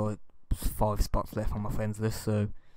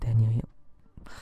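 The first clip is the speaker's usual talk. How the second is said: sexy voice; slower than usual. Pretend. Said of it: "My sexy little voice".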